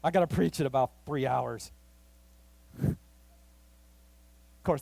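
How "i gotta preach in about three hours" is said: dismissive. Retold or imitated. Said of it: "I'm like".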